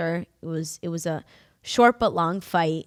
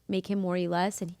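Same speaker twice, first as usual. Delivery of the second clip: normal talking voice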